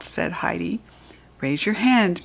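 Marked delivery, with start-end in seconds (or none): none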